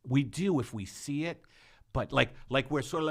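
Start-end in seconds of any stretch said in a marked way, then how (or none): none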